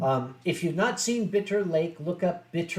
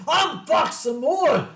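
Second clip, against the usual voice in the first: put-on Scottish kid; 7 semitones higher, 4 dB louder, slower than usual. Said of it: "when he mentioned".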